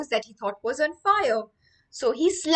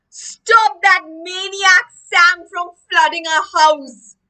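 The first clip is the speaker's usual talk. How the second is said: loud, high, drawn-out appeal; urgent plea; 8 semitones higher, 11 dB louder, slower than usual. Acted. William begging the baby to stop Sam, loud urgent plea. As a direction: call out desperately to the rescuer.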